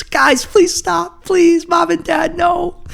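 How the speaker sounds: put-on very high pleading kid voice; child's voice. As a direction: beg like a little kid. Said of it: "like watching my parents fight".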